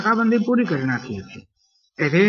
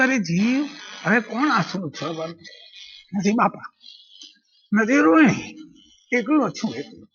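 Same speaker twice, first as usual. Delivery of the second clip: higher, breaking lament trailing to nothing; tearful lament; 3 semitones higher than usual. Acted. Narrator weeping alone for lost Rohini, tearful lament. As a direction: cry out the loneliness until the voice fades. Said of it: "રડતા રડતા".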